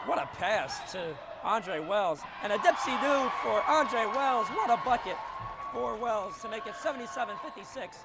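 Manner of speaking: loud, high shout building to the bucket; announcer losing it over a dipsy-do finish; excited call. Real, not acted. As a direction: erupt as the layup drops. Calling a flashy layup.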